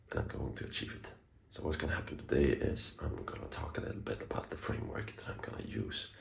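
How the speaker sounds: hushed whisper so the wife sleeps. Real, not acted. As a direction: whisper softly, someone's asleep. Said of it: "I'm whispering because my wife is asleep".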